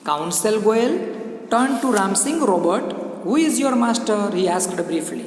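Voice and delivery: slow, lifted classroom reading of English; story read-aloud. Acted. Reads the story text aloud.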